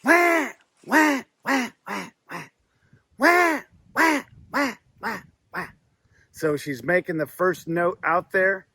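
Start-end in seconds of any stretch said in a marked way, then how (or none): 0.0-2.4 imitated hen duck cadence. Shown as a demonstration. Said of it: "you hear". 3.2-5.7 imitated hen duck cadence. Shown as a demonstration. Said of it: "you hear".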